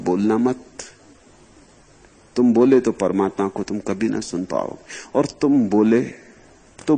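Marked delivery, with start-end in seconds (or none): none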